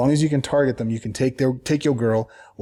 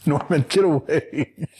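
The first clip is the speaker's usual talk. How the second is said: laughing, slowed-down get away; 4 semitones higher, slower than usual. Real, not acted. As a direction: laugh through the words at the cat. Laughing while pushing cat away.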